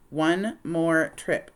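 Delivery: very slow, deliberate condition; slow firm terms. Acted. Nellie laying down the deal, very slow. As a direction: lay down the terms slowly.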